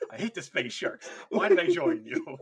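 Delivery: complaint building to a loud wail; mock exasperated outburst. Unwilling recruit regretting joining the space sharks, exasperated.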